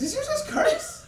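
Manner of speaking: squeaky high mock gasp of disbelief; mock shock. Teasing the co-host for saying hell, mock-shocked falsetto. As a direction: shriek it in hammed-up shock.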